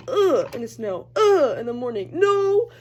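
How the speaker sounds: loud groaning protest building to a high No; groaning complaint. Real, not acted. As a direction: whine loudly about the cold morning. Dreading walk in snow.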